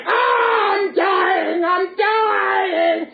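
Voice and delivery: high, long puppet scream; hammed-up dying wail. Punch dies in the crocodile's mouth, high mock wailing shout. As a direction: wail theatrically in death throes.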